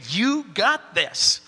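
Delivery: "You got this." drawn out slowly, leaning on You; slow reassurance to the congregation; slow encouraging. Shown as a demonstration. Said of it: "look at you and just say".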